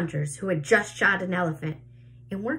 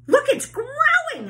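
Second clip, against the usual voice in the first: high excited cry, much louder; shrill cry; 7 dB louder than usual. A narrator's reading. Aunt Spiker cries out as the peach grows, high and loud. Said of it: "Aunt Spiker cried".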